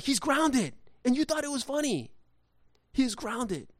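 high, indignant refusal; stern parent. Retold or imitated. Dad telling son's friends he's grounded, stern. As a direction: act out a scolding dad.

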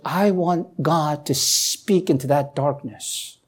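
normal talking voice

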